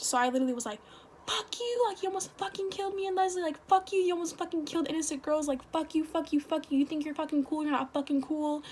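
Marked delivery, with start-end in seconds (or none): 1.2-8.6 retold angry screaming. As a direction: re-enact screaming at him without full volume. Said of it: "I was just screaming at him".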